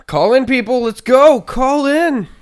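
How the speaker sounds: very loud, high hype shout; rally the crowd, full volume. Real, not acted. Hyping listeners to call.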